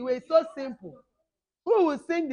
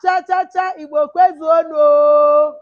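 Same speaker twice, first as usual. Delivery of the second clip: high, very loud crowd call; rousing call for a group answer; rallying shout; 4 semitones higher, 15 dB louder, slower than usual. Real, not acted. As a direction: shout the chant, stretching the last word. Rallying call, audience answers Yeah.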